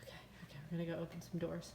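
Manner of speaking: hushed, breathless whisper; frightened whisper. Real, not acted. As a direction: whisper so the bird won't hear. Hiding from bird, whispering.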